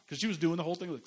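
usual speaking voice

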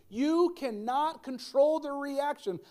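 "You cannot" drawn out slow and high; emphatic repetition. Real, not acted. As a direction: drive home "cannot" slowly. Repeats the key point for listeners.

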